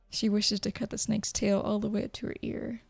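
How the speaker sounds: plain reading voice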